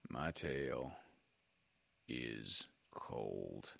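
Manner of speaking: low, slow, soft mournful donkey; gloomy Eeyore. A narrator's reading. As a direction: complain without wanting to complain.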